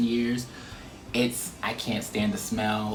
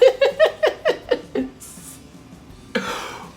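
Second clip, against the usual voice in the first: high, loud incredulous laugh; 3 dB louder than usual. Real, not acted. Laughing at own story.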